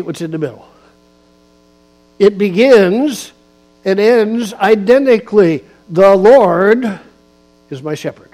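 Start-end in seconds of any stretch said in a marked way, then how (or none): 2.2-8.3 louder emphatic preaching with punched words. Acted. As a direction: drive each phrase home to the pews. Preaches on Psalm 23.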